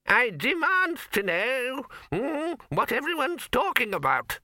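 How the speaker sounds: put-on high, snappy old man demand; snapping. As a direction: snap it out as the old wizard. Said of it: "he snapped".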